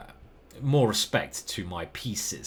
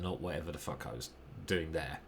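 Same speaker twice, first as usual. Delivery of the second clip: ordinary delivery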